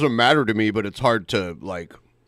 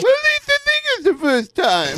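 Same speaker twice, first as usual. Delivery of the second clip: much higher, louder squeaky parody of the host's line; mocking imitation; 4 dB louder than usual. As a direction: mimic him in a silly high voice. Friend mocks the host's line.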